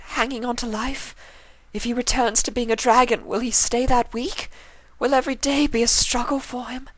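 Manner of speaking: high, tearful lady voice; high, weeping refined lady; sobbing apology. A narrator's reading. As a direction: plead through tears. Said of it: "Rarity began to sob".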